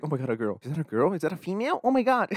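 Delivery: mocking a guy who panics at a girl, pitch climbing; mock starstruck guy. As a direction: gasp like he's never seen a girl. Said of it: "he's like".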